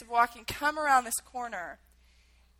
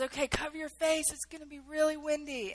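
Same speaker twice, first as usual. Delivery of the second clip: raised pitch without real volume; mild imitation of yelling in an ear; retold yell; 4 semitones higher, 3 dB quieter than usual. Special ops guy warning captives, mild retold yell. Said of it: "yells in our ear".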